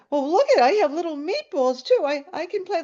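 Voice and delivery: high-pitched playful excitement, acted out; giddy. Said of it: "I started laughing".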